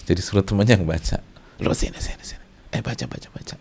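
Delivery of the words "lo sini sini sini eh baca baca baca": hushed, breath-only conspiratorial urging; furtive whisper. Acted. Pupil secretly calling a friend to read the exam, furtive whisper. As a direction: whisper urgently to a classmate, acted.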